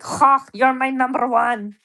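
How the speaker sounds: badly faked German accent, loud and slowed down. Streamer mocking German Love Island contestants, bad German accent. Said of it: "I can't do a German accent".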